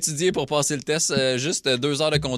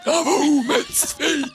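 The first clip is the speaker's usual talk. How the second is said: talking while inhaling; 10 semitones higher than usual. Shown as a demonstration. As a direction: read it while breathing in. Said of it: "un concours de lecture en parlant en aspirant".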